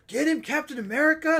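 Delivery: high, loud cheer; imitating an excited yelling fan; retold crowd yell. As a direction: holler it like an audience member egging on the hero. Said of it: "People were yelling".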